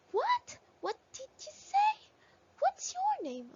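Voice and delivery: very high, loud questioning voice; questioning example. Shown as a demonstration. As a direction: pitch it way up as a surprised-sounding question. Acts out sample questions using what.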